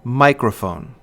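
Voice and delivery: "microphone" stressed on the first syllable, two light after; dactylic stress. Shown as a demonstration. Lecturer giving an example of a dactyl, "microphone" stressed on "mi". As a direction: hit "mi" in "microphone". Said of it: "stressed syllable followed by two unstressed".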